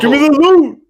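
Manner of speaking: high, loud chanted shout; [name] shout. Retold or imitated. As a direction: belt it like the song hook. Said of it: "makes me think of, uh, [name]".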